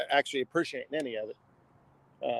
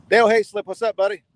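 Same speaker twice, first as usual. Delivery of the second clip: loud greeting; 6 semitones higher, 9 dB louder than usual. Real, not acted. Greeting a viewer in chat.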